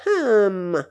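short disapproving grunt; a goading little grumble. A narrator's reading. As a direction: Obnopskine grunting to egg the uncle on. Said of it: "grogna Obnopskine".

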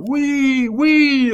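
high, loud, drawn-out yell; full-voiced sustained shout; roaring crowd. A narrator's reading. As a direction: roar it like a mob of thousands. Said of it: "rugirent ces trois mille bouches".

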